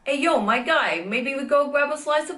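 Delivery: New York accent. Shown as a demonstration. Inviting a pal for pizza, New York accent. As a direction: talk like a New York local hailing a pal.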